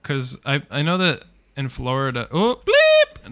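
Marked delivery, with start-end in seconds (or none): 2.3-3.3 sudden exclamation. Real, not acted. Sudden exclamation mid-story.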